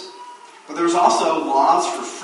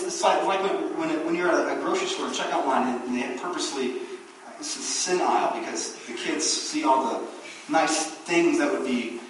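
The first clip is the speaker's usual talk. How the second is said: usual speaking voice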